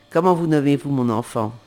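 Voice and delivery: the queen demands a name; queen's question. Acted.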